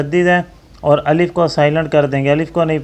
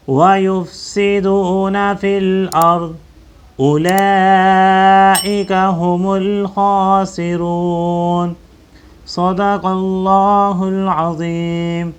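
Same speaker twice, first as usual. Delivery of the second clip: Quran recitation. Acted. Teacher reciting the finished verse to the class in recitation style. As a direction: chant the verse as a reciter would.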